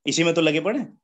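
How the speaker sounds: usual speaking voice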